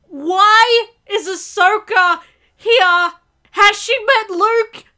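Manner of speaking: loud, high, drawn-out questions; bewildered outcry. Real, not acted. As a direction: demand answers slowly in astonishment. Demanding answers in shock.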